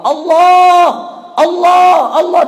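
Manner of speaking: high, loud calling of God; frightened cry. Retold or imitated. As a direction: shout out in sudden fear. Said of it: "tiba-tiba ingat Allah". (seruan ketakutan memanggil Allah)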